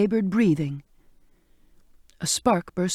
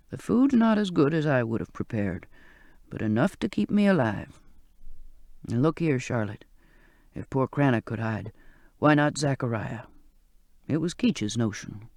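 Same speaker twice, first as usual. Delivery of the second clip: put-on old sailor's voice; 5 semitones lower than usual. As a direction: coax her gently to touch him. Old man explains hiding.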